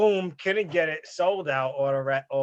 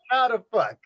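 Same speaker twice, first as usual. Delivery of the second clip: louder, higher mock furious question; hammed-up disbelief and anger; that's how I was; 6 semitones higher, 5 dB louder than usual. To the co-host, showing how he reacted at home, mock furious. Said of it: "that's how I was".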